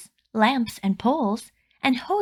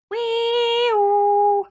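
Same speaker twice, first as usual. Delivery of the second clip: high loud wee-oo siren call; siren imitation; 12 semitones higher, 5 dB louder than usual. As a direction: sing out the ambulance siren like a kid playing. Siren sound for ambulance.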